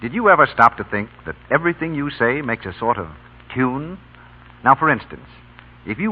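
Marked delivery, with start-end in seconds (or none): none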